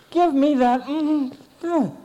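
put-on high, loud, innocent little-boy demand; innocent child. Boy demands the platter from his sister, high and innocent. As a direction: blurt it out proudly like a small kid. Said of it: "very conscientiously and innocently".

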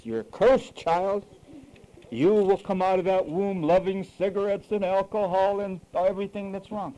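lightly acted voice of doom, stretched opening; mock-pronounce a curse, drawing out the first words; God pronouncing curse. Preacher mimicking God cursing an unborn child, mock pronouncement. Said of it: "Does God put a curse?".

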